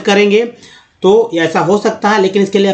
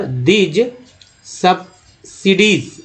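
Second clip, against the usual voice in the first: "Subsidies" split into sub... sidies, drawn out; slow syllable-by-syllable; much slower than usual. Shown as a demonstration. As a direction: sound out "subsidies" slowly in parts. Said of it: "इसको धीरे धीरे पढ़ते हैं". ("Subsidies" को तोड़कर धीरे पढ़ें)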